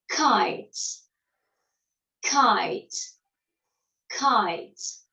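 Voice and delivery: "Kite" with a gliding I diphthong, falling tune; careful "Kite" for the class; teacher modelling "Kite" for repetition; model word. Shown as a demonstration. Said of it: "Répétez".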